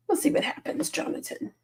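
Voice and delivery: soft teasing whisper; hushed whispered tease. Pretend. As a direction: whisper it like a secret. Teasing reply to a viewer.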